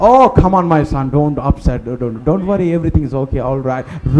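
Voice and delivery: low, soft fatherly reassurance; tender comforting. Acted. Abraham comforts Lazarus held to his bosom, tender and soothing. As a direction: soothe him gently, low and hushed.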